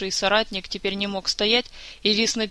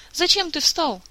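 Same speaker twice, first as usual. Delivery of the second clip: high-pitched worried reproach; alarmed question; 8 semitones higher than usual. A narrator's reading. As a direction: ask in startled concern. Alarmed woman scolds risen patient.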